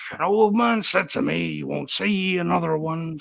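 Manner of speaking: mock-sung stage Irish; hammed-up Irish pub-singer parody; cod Irish accent. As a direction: sing it in a badly faked Irish accent. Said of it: "doing this stupid cod Irish accent".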